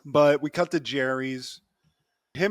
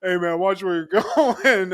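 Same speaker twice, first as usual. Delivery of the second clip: mock nerdy indignation, raised voice; 4 semitones higher, 6 dB louder than usual. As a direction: imitate a nerd telling someone off. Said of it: "it's so fucking nerdy".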